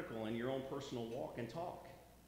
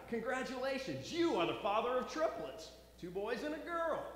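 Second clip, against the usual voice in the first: higher, bright announcing nurse; lightly voiced nurse; 12 semitones higher, 4 dB louder than usual. Nurse telling the second dad the news, higher voice. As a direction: pitch it up as a cheerful nurse.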